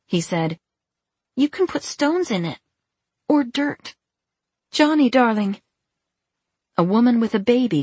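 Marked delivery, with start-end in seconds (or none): 1.3-4.0 put-on high little-boy voice; small boy. Small boy showing John his truck, high and childlike. 4.7-5.6 lightly voiced mother. Mother calls her boy.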